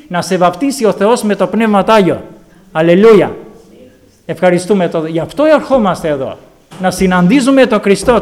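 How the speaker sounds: fervent preacher's cry for the Spirit; preaching. Acted. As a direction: drive the call to pray home. Building exhortation to prayer.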